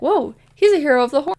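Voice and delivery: amazed whoa. Retold or imitated. Oliver on meeting a hero of the Horn, excited and fast. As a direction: gush it out in awe. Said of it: "and Oliver is like".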